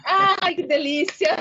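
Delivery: high, loud squeal of delight with a laugh. Real, not acted. Host's guest squeals over chocolate, delighted and laughing. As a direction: gush over a treat, laughing.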